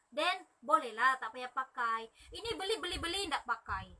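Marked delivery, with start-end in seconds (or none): none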